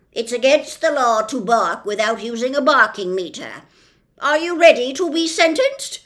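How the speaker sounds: loud, slowish officious policeman; pompous policeman. Acted. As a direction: lay down the law with bluster. Policeman sentencing.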